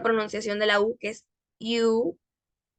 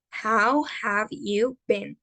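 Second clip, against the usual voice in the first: very slow, careful English model; slow model phrase; much slower than usual. Shown as a demonstration. Teacher leading students to repeat slowly. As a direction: stretch it out for the class to repeat. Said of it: "Pueden repetirlo conmigo".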